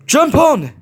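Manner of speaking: high, loud shout from the back. A narrator's reading. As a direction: yell it over the train noise. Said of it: "he shouted from behind them".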